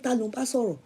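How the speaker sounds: soft, understated "Ta ló ń bá sọ̀rọ̀?"; quiet version. Retold or imitated. First quoting husband's question quietly, mild and soft. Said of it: "the way he said".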